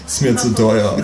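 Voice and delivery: laughing it off; amused laughing refusal. A narrator's reading. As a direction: still chuckling. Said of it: "sag ich lachend".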